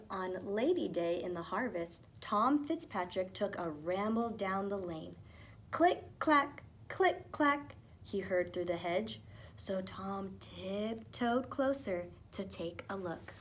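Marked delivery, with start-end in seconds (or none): 5.7-7.8 high, crisp click clack sound; imitated tapping. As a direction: tap it out like a little hammer. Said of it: "The clacking sound".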